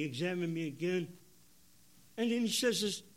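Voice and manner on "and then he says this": normal talking voice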